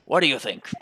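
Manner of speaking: loud, gruff British challenge. Shown as a demonstration. Player testing the inspector's voice, loud gruff British. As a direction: belt it out in a gruff British accent. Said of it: "Gruff, gruff British voice".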